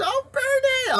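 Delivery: high, breathy-sounding swoon over Bernie; mock the fans' adoration. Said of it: "They just".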